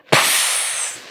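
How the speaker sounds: sharp unvoiced psst; hissed psst. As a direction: hiss to get attention. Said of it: "hissed Grandma".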